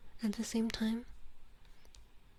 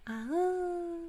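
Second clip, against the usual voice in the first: high, sustained playful howl; wolf howl; 10 semitones higher than usual. Pretend. Streamer welcomes new follower to the Wolfpack, mock howl.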